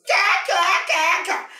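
high, loud, cackling gull voice that jeers; mocking gulls. Gulls jeer at Paddy from the sky, squawking high. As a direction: caw and taunt like a cheeky seabird.

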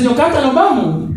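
high-pitched mock urgency; comic hurried summons. Acts out hurrying her away.

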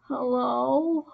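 a lightly faked sick croak; sickest voice. Kid answering the phone, lightly faking being sick. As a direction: put on a mild sick voice for the caller. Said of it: "used my sickest voice".

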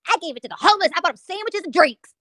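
high, fast, self-righteous mimicry; mock streamer voice. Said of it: "She was like".

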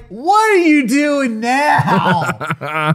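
hammed-up baffled victim; mock bewilderment. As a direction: drag it out, then squeal. Acting Rasputin being tied up.

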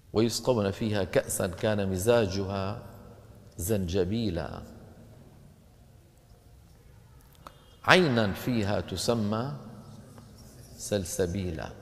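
quiet, slow Quran chant; soft recitation. Acted. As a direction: recite the verse gently, drawing it out. Recites Quran verse softly. (تلاوة هادئة خاشعة)

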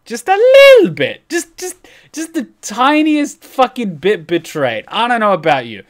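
ordinary talk

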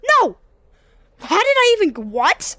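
loud high shout of disbelief; frustrated yell. Real, not acted. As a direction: yell at the game in real frustration. Gamer fails again, yelling.

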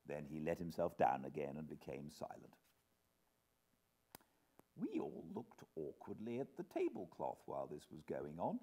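ordinary delivery

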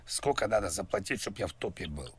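ordinary talk

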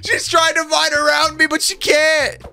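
high, gleeful call; high excited commentary on the player's failing; excited gloating. Real, not acted. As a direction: crow about the trap.

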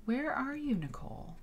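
high, hushed flurry of questions; flustered searching. Real, not acted. Streamer hunting for the lost clip, flustered. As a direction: call after something that has slipped away. Calling after a vanished video clip.

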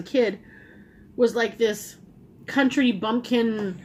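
muffled behind fist; talking through hand. Real, not acted. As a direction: hand pressed to lips. Seen 1: knuckles pressed on lips and chin while speaking, lowered by 3.5.

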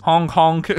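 playful honking noise; silly clown honk. Acted.

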